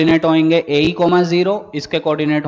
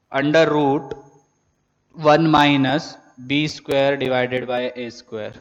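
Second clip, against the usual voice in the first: slow dictation; much slower than usual. Shown as a demonstration. Teacher dictating a formula.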